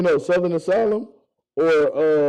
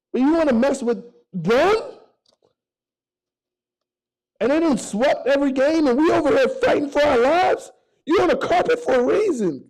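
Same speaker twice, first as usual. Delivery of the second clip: rising from pleading to a fired-up high plea; imitating a coach getting worked up; building heated rant; 8 semitones higher than usual. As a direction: pitch climbing higher line by line, urging Blake. Ty's retold pep-talk rant.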